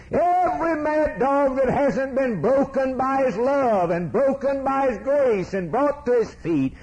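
high, ringing pulpit cry; fiery preaching. Real, not acted. Preacher warns of judgment on rebels, full-force preaching. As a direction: build the list into a high sustained cry.

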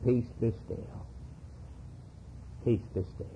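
low and soft, calm and commanding. Retold or imitated. Jesus stilling the wind and waves, soft and commanding. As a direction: hush the storm with quiet authority. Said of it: "he raised his hand and he said".

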